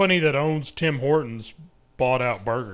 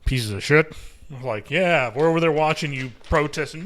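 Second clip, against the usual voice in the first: usual speaking voice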